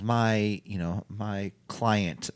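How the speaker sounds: very slow, deliberate announcement; slow manager speech. Retold or imitated. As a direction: drag it out like a manager.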